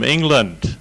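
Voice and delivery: ordinary talk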